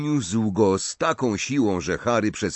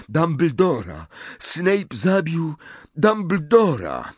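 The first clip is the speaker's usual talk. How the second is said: higher, slow insisting voice; urgent Harry; 7 semitones higher than usual. A narrator's reading. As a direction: force the awful truth out. Harry delivers terrible news. (Harry wstrząśnięty, wyżej i wolno)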